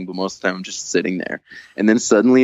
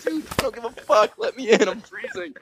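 high exasperated yell. Retold or imitated. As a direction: yell to be let in, much higher. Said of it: "I'm literally yelling".

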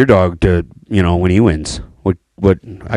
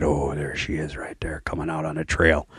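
soft hushed spotting; 6 semitones lower, 12 dB quieter than usual. Retold or imitated. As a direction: whisper it like in a stand. Retold hunting whisper.

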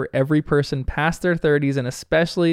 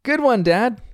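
high-pitched cheering son; imitated encouragement; 9 semitones higher, slower than usual. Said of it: "encourages his dad back".

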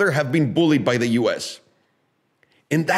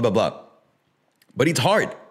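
normal talking voice

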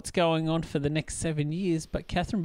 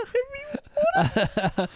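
much higher, slow, laughing through it; drawn-out laughing repeat; much slower than usual. Real, not acted. Repeating the title to her co-host, cracking up. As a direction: stretch the words out while cracking up.

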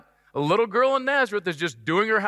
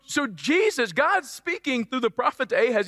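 ordinary delivery

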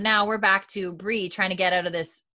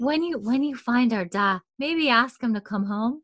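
higher, wistful imitation of a dreamy girl; wistful Luna Lovegood; 4 semitones higher than usual. Joni asking for her da, imitated wistfully. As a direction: put on a gentle, wistful plea. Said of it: "like, a very wistful voice".

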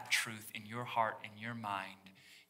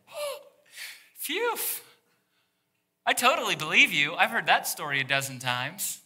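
mock relief, pitched way up and louder; a hammed-up sarcastic phew, then a louder, faster sneer; sarcastic Joseph; 6 semitones higher, 10 dB louder than usual. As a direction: play Joseph's fake belief, all sarcasm.

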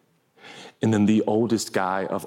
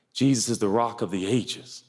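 ordinary talk, nothing marked

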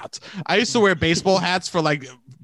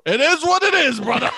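high, hearty buddy dismissal; rousing reassurance; 10 semitones higher, 4 dB louder than usual. Acted. To a married buddy confessing a pregnancy, rousing and high. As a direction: belt it out to wave off trouble.

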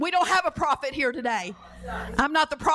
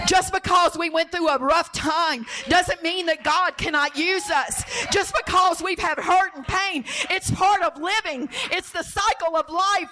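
raised, insistent preaching cadence; impassioned preaching; 5 semitones higher than usual. Real, not acted. Preacher telling hurting people God can use them, impassioned. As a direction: press each phrase home to the congregation.

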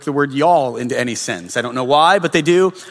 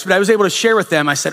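ordinary talk, nothing marked

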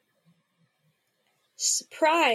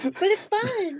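playful character voice; 9 semitones higher, 4 dB louder, slower than usual. Acted. Billy's playful reply.

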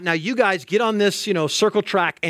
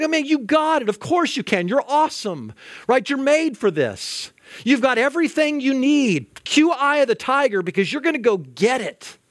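higher, hyped-up mock encouragement; mock cheerleading; 6 semitones higher than usual. Preacher mimicking how we cheer on a doubting friend, mock hyped. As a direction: gush like a hammed-up hype man.

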